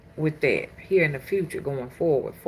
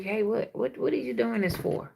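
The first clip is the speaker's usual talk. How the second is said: calming voice; 5 dB quieter than usual. Retold or imitated. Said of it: "just tell you".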